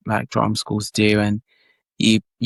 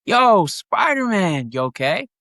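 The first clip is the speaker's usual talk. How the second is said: slow, much higher New York bystander call; movie line reenacted; 8 semitones higher than usual. Shown as a demonstration. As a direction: call out to the hero, slow and raised. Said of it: "if you wouldn't mind saying it".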